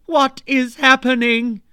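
high loud panicked cry; scream. Retold or imitated. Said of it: "I screamed".

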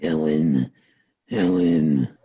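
put-on deep, drawn-out old man calling Helen; Stu Hart impression. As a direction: call out low and slow as Stu Hart. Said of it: "That's my version of Stu Hart".

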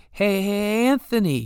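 high, loud whiny Boston yell; yelling impression of the promoter. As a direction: call out to the ring crew like the promoter. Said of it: "he would often yell out to the ring crew".

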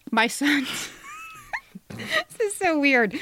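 high, slow, laughing through the words; real laughter breaking the reading; cracking up. Real, not acted. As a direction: lose it mid-sentence and giggle.

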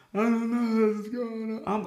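hammed-up sobbing bewilderment, slow; mock crying. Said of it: "if I'm crying about something".

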